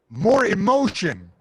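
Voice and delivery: slow drawn-out yell of advice; coach yelling. Retold or imitated. Coach yells to his fighter, slow loud call. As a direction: bellow it from the corner. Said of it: "I heard my coach yell".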